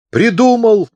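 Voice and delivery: high, loud burst of inspiration; animated. A narrator's reading. Deaf chaplain suddenly has an idea, excited. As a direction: cry out as the idea strikes. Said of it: "оживился он".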